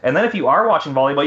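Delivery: normal talking voice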